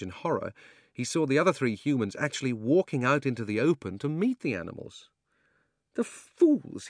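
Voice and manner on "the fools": put-on high, sneering old man; scornful old man. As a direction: spit it out in contempt. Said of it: "he said to himself".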